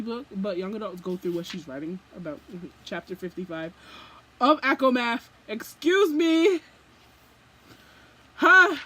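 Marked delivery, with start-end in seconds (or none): 4.4-6.6 loud, high, drawn-out "excuse me"; scandalised by what the author wrote; shocked outburst. Real, not acted. Reader outraged at chapter fifty-five, loud shocked cry. 8.3-8.8 loud, high, drawn-out "excuse me"; scandalised by what the author wrote; shocked outburst. Real, not acted. Reader outraged at chapter fifty-five, loud shocked cry.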